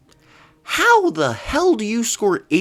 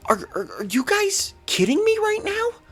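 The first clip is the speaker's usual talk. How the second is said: incredulous. Real, not acted. Fan ranting at team.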